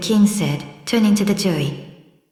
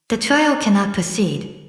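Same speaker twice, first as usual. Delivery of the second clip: plain reading voice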